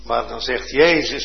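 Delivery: usual speaking voice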